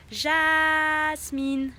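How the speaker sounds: Jas-mine, each syllable stretched slowly and loud; a slow, marked "Jasmine" for a pet rabbit. Shown as a demonstration. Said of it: "il va falloir appuyer les, les syllabes".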